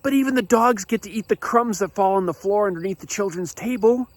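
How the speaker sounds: high-pitched, urgent pleading; acted-out desperate appeal; desperate plea. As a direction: plead as if this is your last hope. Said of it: "in desperation".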